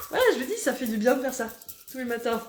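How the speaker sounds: usual speaking voice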